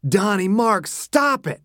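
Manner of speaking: mild narrated yell, rising in pitch. A narrator's reading. Bobby to two arguing men, yelling. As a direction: call out to break up a fight. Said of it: "Bobby yelled".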